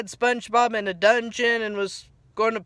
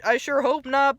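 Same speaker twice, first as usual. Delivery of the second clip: ordinary talk, nothing marked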